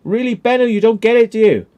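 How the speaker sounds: high, loud, incredulous scold; exasperated scolding. Retold or imitated. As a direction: talk down to him in disbelief. Exasperated telling-off of Benno.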